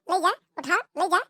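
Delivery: ordinary talk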